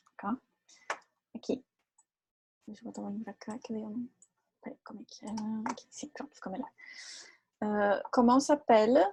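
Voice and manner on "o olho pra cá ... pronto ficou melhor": barely voiced, fiddling with the screen; whispered aside. Real, not acted. Teacher whispering to herself while fixing her screen. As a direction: whisper to yourself while adjusting things.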